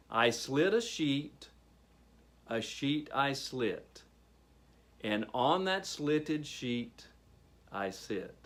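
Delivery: slow, deliberate recital, line by line with pauses; careful tongue twister. Shown as a demonstration. Workshop leader gives a tongue twister to repeat, slow and careful. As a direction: model each phrase slowly for them to copy. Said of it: "Now repeat after me".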